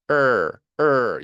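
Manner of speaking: "으" held like "er", tongue drawn back; two long, falling r-coloured "으" sounds; English R sound. Shown as a demonstration. Teacher shows what the R sound is, "으" retracted and voiced. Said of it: "알 발음은".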